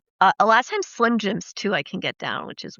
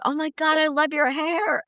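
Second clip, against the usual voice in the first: a hint of a higher gushing lady; a lady on the mountaintop gushing over her hair, lightly voiced higher; gushing stranger; 6 semitones higher than usual. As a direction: lightly mimic an excited stranger. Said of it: "a lady up there that was like".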